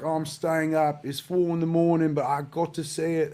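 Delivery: hammed-up British accent from a UK fan; bad British accent. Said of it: "That's the worst British accent you've ever heard".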